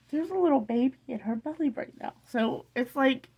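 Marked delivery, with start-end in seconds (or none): 0.1-2.1 high, quick, tearful voice; emotional. Real, not acted. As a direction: squeeze the words out through happy tears. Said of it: "sorry for being so emotional".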